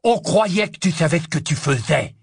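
loud, high indignant outburst; angry exclamation. Acted. Ron bursts out at Harry as he gets up, angry. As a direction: throw the blame back loudly. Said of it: "s'exclama Ron".

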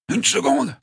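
very high, straining insistence; strained repetition. A narrator's reading. Mike's last words, strained and high. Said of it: "répéta-t-il".

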